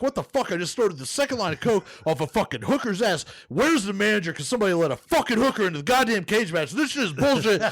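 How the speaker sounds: high, impassioned mock-outraged rant; worked-up comic fury; intense. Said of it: "The intensity".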